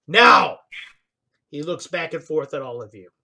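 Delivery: ordinary talk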